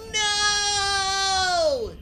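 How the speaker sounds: long, very high, loud wail; anguished SpongeBob yell. Acted. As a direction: scream it out high and drawn-out. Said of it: "begins to le-, yell".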